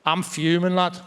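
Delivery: low-pitched Scouse grumble of anger; fuming Scouse. Acted. Comedian shows second state of Scouse being, fuming. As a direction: open the Scouse rant low, simmering. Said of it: "the second state of Scouse being".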